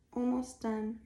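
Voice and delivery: normal talking voice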